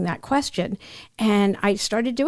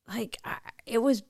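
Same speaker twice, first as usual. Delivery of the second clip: breath drops to a frightened whisper; scared whisper; 6 dB quieter than usual. Real, not acted. Guest recalling money fears to host, real scared whisper. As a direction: voice fades to a whisper, reliving the fear. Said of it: "And I was so scared".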